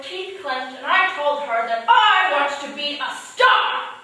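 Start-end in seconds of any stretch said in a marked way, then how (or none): 1.9-3.9 loud and slow, chin out; defiant declaration. Retold or imitated. Answering her teacher, a slow loud defiant vow. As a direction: plant each word, slowly and loudly. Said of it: "I got right up in her face".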